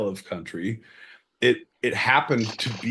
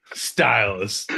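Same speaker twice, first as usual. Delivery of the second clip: ordinary delivery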